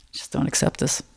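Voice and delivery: unvoiced whisper of a private vow; whispered to herself. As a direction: breathe it to yourself, barely voiced. Inner vow while dunking.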